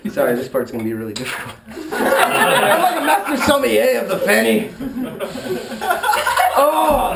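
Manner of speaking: ordinary delivery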